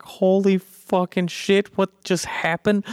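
high, slow stunned exclamation; shocked disbelief. Retold or imitated. Flash reacting to Superman's speed, stunned. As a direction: gape at what just happened. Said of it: "and he's like".

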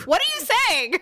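high, alarmed mother voice; a confused mom demanding answers. Retold or imitated.

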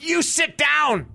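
mock shouted command. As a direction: order him down like a drill sergeant. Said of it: "and she says".

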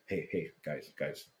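usual speaking voice

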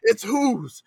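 high, exasperated protest; mock exasperation. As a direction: plead that it wasn't you. Retold exasperated reply to repliers.

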